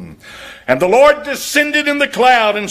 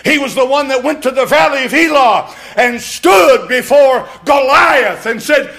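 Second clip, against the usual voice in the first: loud, higher, slowed story preaching; fiery preaching; 4 semitones higher, 3 dB louder than usual. Acted. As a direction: build to a roaring climax. Preacher building to climax.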